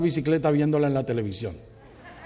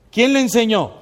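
normal talking voice

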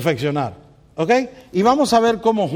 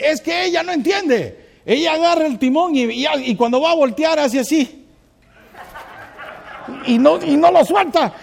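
much higher, whiny husband imitation; high mocking husband complaint; complaining husband; 11 semitones higher, 4 dB louder than usual. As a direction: mimic the husband griping about his wife. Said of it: "Y el hombre dice".

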